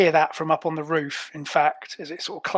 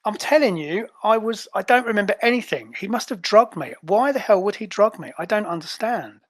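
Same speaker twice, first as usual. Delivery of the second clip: higher-pitched, upset agent demanding answers; aggrieved and confused, pitched up; indignant Azure; 4 semitones higher than usual. Acted. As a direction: play a bewildered agent, voice raised in pitch.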